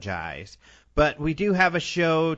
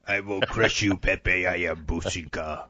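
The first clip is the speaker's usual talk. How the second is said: menacing tough dog, dropping lower; barbarian; 4 semitones lower than usual. Pretend. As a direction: threaten like a tiny warlord. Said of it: "Hey, you do that pretty well".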